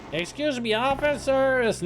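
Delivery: calling out. Retold or imitated. Calling out across a big hall.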